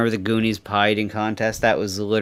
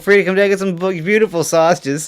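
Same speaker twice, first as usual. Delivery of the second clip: ad read; 9 semitones higher, 6 dB louder than usual. Acted. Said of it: "I wanted to start doing live reads".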